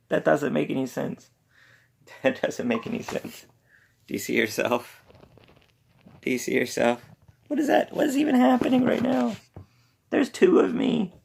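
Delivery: high-pitched, giggly disbelief; amused bafflement. Real, not acted. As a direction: squeak in bewilderment at the pet. Baffled by pet's reaction to camera.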